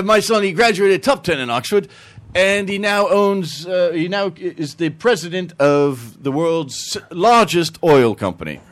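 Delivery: put-on Englishman accent. Englishman boasting of his Oxford son, English accent. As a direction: brag slowly as a put-on Englishman. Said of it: "the Englishman, he goes".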